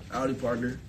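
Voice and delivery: low, soft cowboy greeting. Acted. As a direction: drop low and quiet like a cowboy. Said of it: "I'm like someone's cowboy sugar daddy".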